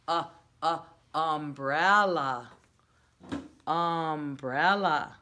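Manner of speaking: teacher modeling the word slowly; slow sounding out. Shown as a demonstration. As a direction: stretch every syllable of "Umbrella" for the class. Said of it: "teacher sounding out the word".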